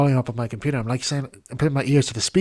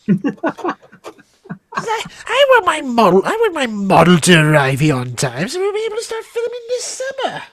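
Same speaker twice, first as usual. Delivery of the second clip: much higher and louder diva whine; high Daisy voice; 14 semitones higher, 6 dB louder than usual. Retold or imitated. Daisy demanding her model arrive on time, high and petulant. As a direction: insist like a spoiled star. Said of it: "He says, he says".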